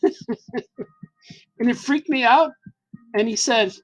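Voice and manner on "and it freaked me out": slow words through a real laugh; chuckling slowly at his own embarrassment. Real, not acted. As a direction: laugh it out as you tell it. Laughing while retelling awkward moment.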